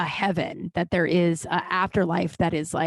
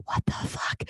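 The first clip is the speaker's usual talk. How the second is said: whispered disbelief; 7 dB quieter than usual. Retold or imitated. Stunned reaction to the celebrity.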